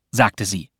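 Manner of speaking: ordinary delivery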